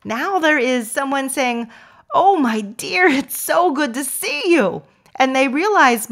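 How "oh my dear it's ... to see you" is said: positive sound. Shown as a demonstration. Greeting a stranger as my dear, sweet and high. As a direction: greet them like a dear old friend. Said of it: "that was said with a positive sound".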